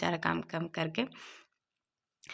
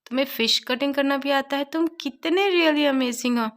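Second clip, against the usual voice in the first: higher, slow, gushing admiration; excited; 6 semitones higher, 4 dB louder, slower than usual. Retold or imitated. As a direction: gush over him in awe. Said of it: "बहुत excited हो जाता है".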